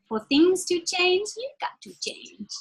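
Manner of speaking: high, slow, breathy voice of Jim Rohn; lightly imitating the speaker she hears. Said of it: "I can even hear him saying it".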